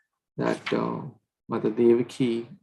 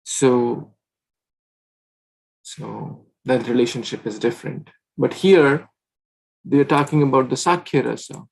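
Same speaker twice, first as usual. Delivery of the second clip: usual speaking voice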